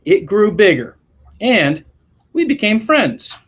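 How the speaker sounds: gentle storybook read-aloud voice; expressive classroom story reading; reading to children. A narrator's reading. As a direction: read it like a picture book to a class. Reads picture book aloud.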